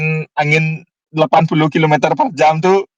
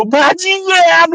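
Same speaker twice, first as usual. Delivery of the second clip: high, shouted curse; imitated joint yell; 12 semitones higher, much slower than usual. Two friends cursing together after work, high yell. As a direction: cry it out high as if in chorus. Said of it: "kita berdua ngomong".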